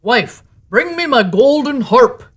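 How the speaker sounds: loud, slow giant command; ogre calling out. A narrator's reading. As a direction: call for the harp, heavy and loud. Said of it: "the ogre called out".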